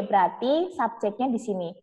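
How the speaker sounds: normal talking voice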